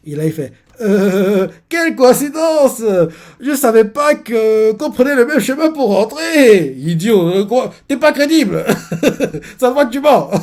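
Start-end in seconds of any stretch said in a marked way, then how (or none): 0.8-6.7 high, loud, slow hammed-up innocence; fake innocent classmate. Jealous classmate caught tailing them, hammed-up fake surprise. 6.8-10.3 high, loud, laughing jeer at the liar; laughing mockery. Real, not acted. Viewer laughing at the caught classmate, loud mockery.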